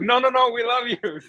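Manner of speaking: high, fast reassuring pleading. Retold or imitated. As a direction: reassure him, high and eager. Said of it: "He's like".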